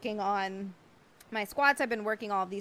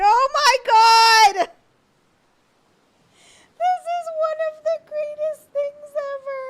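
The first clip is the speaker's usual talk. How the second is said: excited squeal; 14 dB louder than usual. Real, not acted. Thrilled by a viewer's picture.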